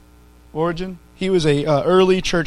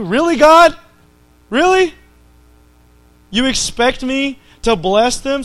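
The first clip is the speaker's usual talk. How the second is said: mock incredulous protest; 5 semitones higher than usual. Preacher protesting to God about the verse, incredulous. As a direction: plead in disbelief to God.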